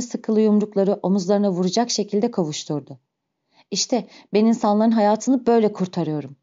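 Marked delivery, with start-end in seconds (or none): none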